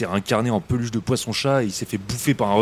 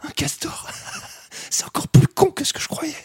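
stifled whisper; 13 semitones higher than usual. Acted. Hiding on dune watching creatures.

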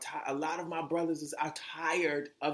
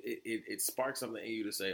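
ordinary talk, nothing marked

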